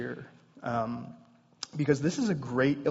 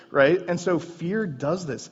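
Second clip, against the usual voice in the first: ordinary talk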